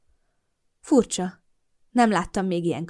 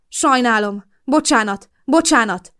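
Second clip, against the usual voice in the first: higher, louder desperate apology; frightened man apologising as he flees; frantic apology; 5 semitones higher, 7 dB louder than usual. A narrator's reading. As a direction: beg forgiveness in panic.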